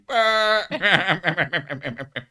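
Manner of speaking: high, loud mock child laugh; hammed-up bleating kid laugh, in a long rattle; English children's laugh. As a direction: imitate schoolkids cackling at a rude word. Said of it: "That's how English children laugh".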